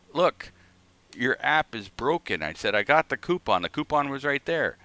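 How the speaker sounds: telling off the cashier over a broken app, mock angry; retold angry complaint. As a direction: replay the complaint half-heated, pushing up.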